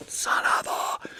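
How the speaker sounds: hushed muttered oath; under the breath, dragged out; whispered self-reproach. Real, not acted. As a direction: whisper it to yourself on catching the slip. Catches own mistake, mutters curse.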